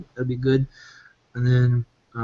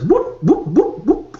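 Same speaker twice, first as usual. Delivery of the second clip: high, rising whoop whoop calls; gleeful whoops; 8 semitones higher, slower than usual. Acted. Merchant cheers over the sale, high whooping calls. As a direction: whoop it up, pitched way up. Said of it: "He goes".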